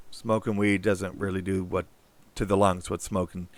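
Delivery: ordinary talk, nothing marked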